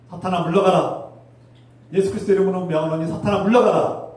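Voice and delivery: loud rising rebuke of Satan; commanding shout. Real, not acted. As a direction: cast the devil out with full force. Preacher commands Satan to leave. (사탄을 꾸짖는 우렁찬 외침)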